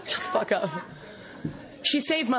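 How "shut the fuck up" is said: lower, deadpan meaning behind it; blunt translation. Retold or imitated. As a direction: say what she really means, flatly. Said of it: "Which in Toronto is lingo for".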